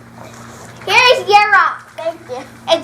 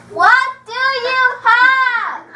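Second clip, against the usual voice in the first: slow, sing-song, every word stretched; drawn-out sing-song question; slow, drawn-out ask; 4 semitones higher, much slower than usual. Acted. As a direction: spell it out slowly for a deaf waiter. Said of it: "talking in a nice indoor voice".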